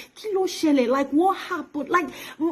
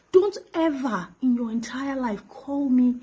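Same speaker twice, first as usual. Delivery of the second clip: ordinary delivery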